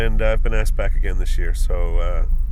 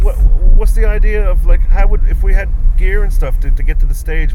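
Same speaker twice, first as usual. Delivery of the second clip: louder and higher, venting his outrage; exasperated rant; 4 semitones higher, 11 dB louder than usual. Real, not acted. Performer complains to his manager about no parking, exasperated and loud. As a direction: throw up your hands at the absurd parking rules.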